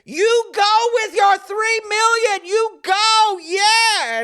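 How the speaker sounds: high, loud, stretched-out cheer. Retold or imitated. Girl cheering the puppeteer on across a parking lot, shouting excitedly. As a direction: imitate a fan yelling encouragement. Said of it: "suddenly I have a cheering section".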